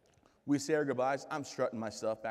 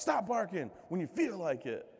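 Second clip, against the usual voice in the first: mock dog command; 7 semitones higher than usual.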